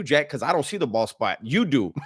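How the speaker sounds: ordinary delivery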